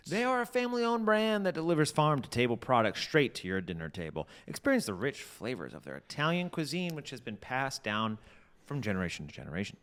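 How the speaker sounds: bright commercial announcer; ad read. Acted. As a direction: pitch the product like an ad. Fake ad read.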